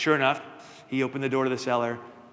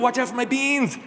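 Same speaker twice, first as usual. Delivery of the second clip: much higher, louder startled yelp; startled cry; 11 semitones higher, 5 dB louder than usual. Acted. Jack jumps as the torso rolls toward his beans, high startled yelp. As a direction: yelp it out as something lands right by you.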